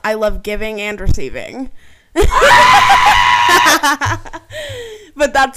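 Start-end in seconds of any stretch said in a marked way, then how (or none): 2.1-5.1 loud high cackle of laughter; loud burst of laughter. Real, not acted. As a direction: throw your head back and howl with laughter.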